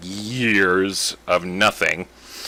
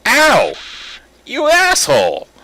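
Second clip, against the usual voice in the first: much higher, louder angry yell; indignant yell; 6 dB louder than usual. Real, not acted. Player hurt by pellets, loud angry yell. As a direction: yelp then curse. Hit by the flower's attack.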